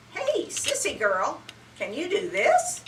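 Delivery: frog talking. Acted. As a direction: call out like a playful frog. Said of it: "he said suddenly".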